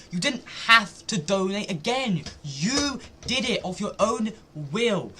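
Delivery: high, slow, emphatic protest; exasperated insistence. Real, not acted. Streamer rejecting the donor's excuse, exasperated and emphatic. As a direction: drive each word home slowly. Heated rebuttal to video.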